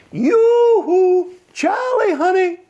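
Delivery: very high, sweet calling voice; tinkly pretty voice. Acted. Mermaid calling sweetly to Charlie from the weir. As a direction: call out like a coy little mermaid. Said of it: "the same sweet voice says".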